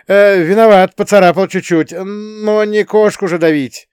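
high, loud whining driver; plaintive high-pitched protest; plaintive excuse. A narrator's reading. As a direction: whine an apology, drawing out the end. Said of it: "жалобно ответил водитель".

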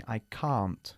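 "can't" with the long open A of "car"; British can't. Shown as a demonstration. Teacher shows students the British "can't" with a car vowel. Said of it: "El mismo sonido que nuestra palabra casi para coche".

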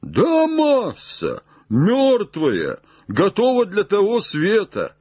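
high, stretched-out holler; calling down. Acted. Jupiter answers his master from the branch, high call.

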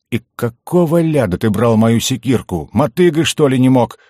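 loud grumpy griping; disgruntled grumbling. A narrator's reading. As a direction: grouse indignantly about a borrowed axe. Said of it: "недовольно бурчал Делер".

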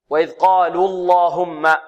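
اللهم said slowly, lam thick after the open vowel; heavy lam in اللهم. Shown as a demonstration. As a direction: stretch وإذ قالوا اللهم with a full heavy lam. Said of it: "جاء قبلها مفتوح". (تفخيم اللام في اللهم)

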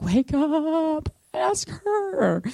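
high, strained, drawn-out pleading; whiny plea. Retold or imitated. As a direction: whine it through a door, acted out. Reluctant plea outside a door.

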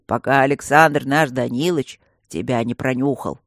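put-on low, loud old grumbling voice; old stone guardian. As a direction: lecture her like a gruff grandfather. Old stone guardian lectures girl.

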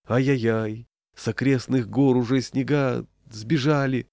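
usual speaking voice

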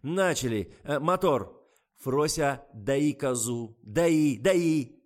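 loud, high, urgent orders; director's commands. A narrator's reading. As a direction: shout the action from behind the camera. Director shouting commands on set.

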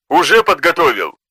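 clearly offended retort. A narrator's reading. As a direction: answer with wounded pride. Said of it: "В голосе Белата прозвучала явственная обида".

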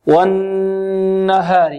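والنهار with the doubled noon hummed long; long, steady nasal hum inside والنهار; held ghunna. Shown as a demonstration. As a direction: recite والنهار slowly, lingering on the nasal n. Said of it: "يؤخذ بالتلقي والمشافهة".

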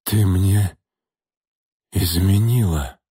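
heavily wheezed, out of breath; heavy wheeze. Acted. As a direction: rasp it out, still catching his breath. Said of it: "тяжело прохрипел Ольшанский".

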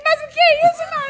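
high, loud mock astonishment; shocked husband. Dad walking in on the fashion show, high mock shock. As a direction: exclaim it like an aghast husband. Father shocked on coming home.

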